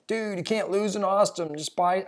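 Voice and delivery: put-on botched Texas accent; failed Texas accent. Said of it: "I can't do a Texas accent".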